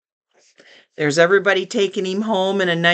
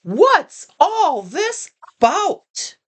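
shouting; 9 semitones higher, slower than usual. A narrator's reading. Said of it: "the people came shouting".

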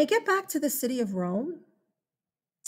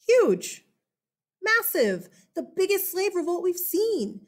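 emphatic; 7 semitones higher than usual. Acted. Teacher stressing size of revolt.

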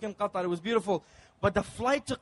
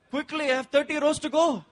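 higher, louder rushed steward; hurried steward; 6 semitones higher, 4 dB louder than usual. Retold or imitated. Steward rushing the passenger to eat, higher and louder. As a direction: hurry him, you're behind schedule. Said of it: "He's like".

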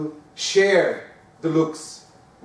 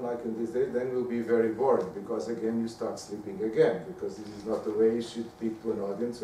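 low, quiet, unchanging drone; monotonous tone; 8 semitones lower, 9 dB quieter than usual. Shown as a demonstration. Lecturer demonstrating a boring monotone to students, low and quiet. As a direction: drone on to show how boring it sounds. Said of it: "talking like in a monotonous tone".

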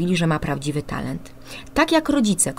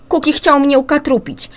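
higher, louder whiny tattling; complaining boy; 9 semitones higher, 6 dB louder than usual. Acted. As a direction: tell on your brother to mum. (wyższy, głośniejszy, skarżący się chłopiec)

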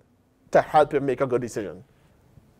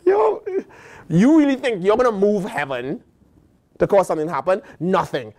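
ordinary delivery

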